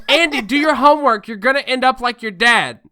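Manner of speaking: loud, high mock-mom yell; scolding mom. Mom yelling at child about homework, mock scolding. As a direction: holler it like an exasperated mom.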